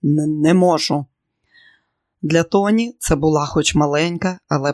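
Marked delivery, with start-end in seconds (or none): 0.0-1.0 firm refusal with a slight stumble at the start; metallic edge. A narrator's reading. Son refuses his mother's boating suggestion, touch of metal in voice. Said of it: "лунали металеві нотки".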